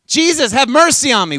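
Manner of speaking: loud, high cry for mercy; shouted plea. Acted. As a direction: call out over a crowd. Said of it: "remember the first time".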